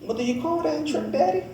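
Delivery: put-on high, soft child's question; little girl asking. Daughter asking her dad about the magic trick, high and soft. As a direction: ask it like a hopeful little girl. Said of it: "She said".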